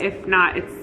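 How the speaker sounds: ordinary talk, nothing marked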